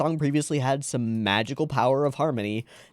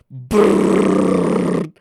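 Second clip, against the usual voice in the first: loud buzzing lip trill; vibration buzz; 6 semitones higher, 8 dB louder than usual. Shown as a demonstration. Imitating a vibrating hand.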